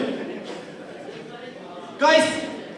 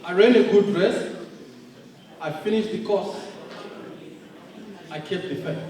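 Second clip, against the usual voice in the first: solemn scripture quote; 9 semitones lower, 5 dB quieter than usual. Retold or imitated. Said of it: "and he would say".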